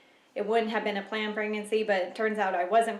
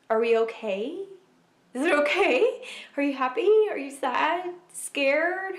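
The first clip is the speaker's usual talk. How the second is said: high, louder string of worried questions; anxious checking; 7 semitones higher, 5 dB louder than usual. Retold or imitated. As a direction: check urgently on a tearful partner, pitch climbing. Retold anxious check on tearful husband.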